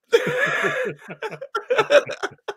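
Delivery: high burst of laughter; big laugh. Real, not acted. Cracking up at the friend's battle cry, a high burst of laughter.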